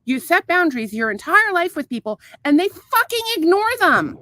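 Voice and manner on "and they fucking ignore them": higher, louder and slow, every word hit; angry emphasis. Real, not acted. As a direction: slam each word home, furious. Ranting about ignored boundaries.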